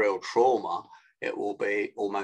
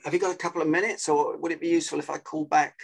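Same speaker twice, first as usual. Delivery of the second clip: quick, higher-pitched phone request; fast mirroring pace; 5 semitones higher, much faster than usual. Shown as a demonstration. Coach plays a caller offering to ring back, fast. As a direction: hurry through it for a busy listener. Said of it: "said it at a fast pace".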